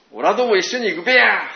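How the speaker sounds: loud, high rustic Tohoku call; Tohoku dialect. Retold or imitated. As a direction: shout オラども一緒に行くべや with Tohoku べや ending. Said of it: "こういう感じですね". (東北弁で威勢よく誘う)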